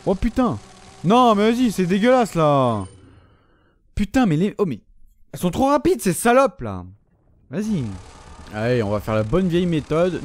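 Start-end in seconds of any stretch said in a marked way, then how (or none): 0.1-7.8 high, loud exasperated outburst; frustrated yelling. Real, not acted. Gamer raging at monsters too fast to dodge, loud and high. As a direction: vent at the cheap enemies.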